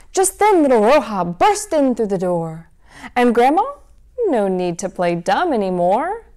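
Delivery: lively storybook read-aloud; reading to children. A narrator's reading. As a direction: read it like a bedtime story for kids. Reads picture book to children.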